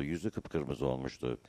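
usual speaking voice

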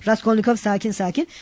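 ordinary delivery

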